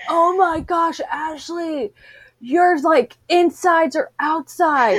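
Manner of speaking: high, a hint of a stunned husband; slow, gawking at it; shocked husband. Husband peeking over curtain at wife's insides, mock shocked. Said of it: "he peeked over and he was like".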